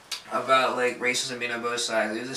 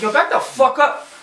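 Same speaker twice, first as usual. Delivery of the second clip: retold yell; 10 semitones higher, 6 dB louder than usual. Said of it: "I was like".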